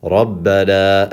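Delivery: slow Rabbana with held bb and long final aa; drawn-out final vowel in Rabbana; madd. Shown as a demonstration. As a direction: stretch the end of Rabbana. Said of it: "there's that squiggly line".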